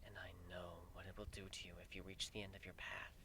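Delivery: a grave goddess murmuring a prophecy of doom; hushed solemn goddess. Acted. Goddess warning Griffin his hate will kill him, hushed and grave.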